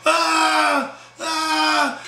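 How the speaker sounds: high, drawn-out "ah", twice; mock scream. Storyteller mimics Brian's girl at the fight, mock scream. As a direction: imitate a screaming woman with long high wails. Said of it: "Sophia screaming".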